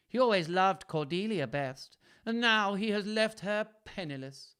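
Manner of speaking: high, loud character voice of an aggrieved daughter; Regan's complaint. A narrator's reading. Daughter complains to her sister about father, high stagey complaint. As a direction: play Regan complaining, pitched well up. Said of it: "murmured Regan".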